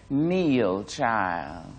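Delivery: a hint of an old church mother's rasp; understated old lady's command, dropping low and soft; scratchy old voice. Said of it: "one said in a scratchy voice".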